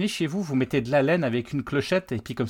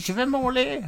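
high nasal whine, laughing; nasal whiny voice; 11 semitones higher than usual. Retold or imitated. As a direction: whine it like a needy grown man. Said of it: "qui dit".

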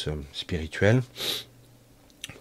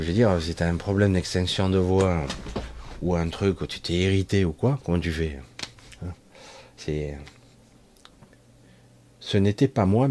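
ordinary talk, nothing marked

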